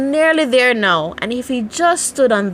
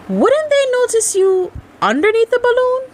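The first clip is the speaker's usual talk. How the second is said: lightly voiced curious child, higher; child's question; 3 dB louder than usual. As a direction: ask it wide-eyed like a small boy. Child's voice asks Pooh.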